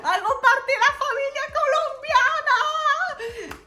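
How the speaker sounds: grito agudo y alegre entre risas, alargando el final; euforia con risa. Retold or imitated. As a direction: celebra como si hubiera ganado algo. Aceptada por la vendedora. (alegría desbordada al sentirse aceptada, riendo)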